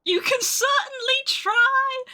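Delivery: high, slow mocking threat; menacing high taunt. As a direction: taunt them, drawn out. Player voices threat to bandits.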